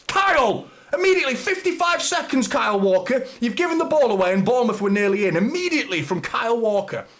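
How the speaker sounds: furious fan shouting at Kyle Walker; exasperated yell. Real, not acted. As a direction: bellow your disbelief at the giveaway. Player gives ball away.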